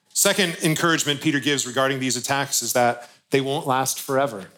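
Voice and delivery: normal talking voice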